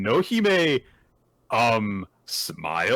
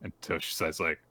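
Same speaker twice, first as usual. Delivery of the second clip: ordinary delivery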